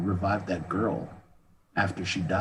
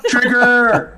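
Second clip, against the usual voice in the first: laughing; 12 dB louder than usual. Real, not acted. Laughing punchline reference.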